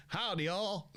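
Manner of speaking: mock American drawl greeting; American accent. Friend back from America greets sixth form, American accent. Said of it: "there's an American boy".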